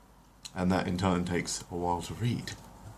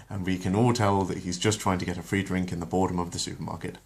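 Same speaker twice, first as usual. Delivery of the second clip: plain reading voice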